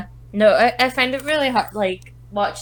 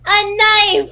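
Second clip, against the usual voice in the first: put-on much higher and louder, proud little kid; excited toddler; 11 semitones higher, 7 dB louder than usual. Toddler tells his mum what he is holding, high proud shout. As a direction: blurt it out like a thrilled toddler.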